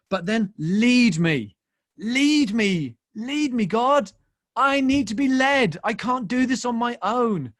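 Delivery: loud, high plea to God; pleading prayer. Acted. Preacher begging God to lead him, pleading. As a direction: cry out to be led, drawing out the first words.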